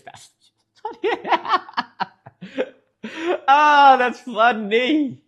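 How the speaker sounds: slow, loud laugh through the words; real laughter at a funny chat story. Real, not acted. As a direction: let the laugh spill over the line.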